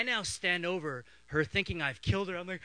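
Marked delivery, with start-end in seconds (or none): none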